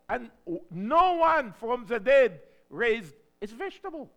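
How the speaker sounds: loud, high prayer building to a cry; fiery preached prayer. Acted. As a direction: declare it to the Lord in full voice.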